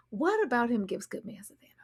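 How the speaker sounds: breathy whisper; whispered snark; whispered aside. Real, not acted. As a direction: lean in and whisper it.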